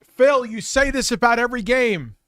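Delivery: loud, high-pitched scolding of Phil; bursting out at Phil, yelling; exasperated yell. Retold or imitated. Streamer yelling at Phil over his game complaints, exasperated. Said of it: "be like".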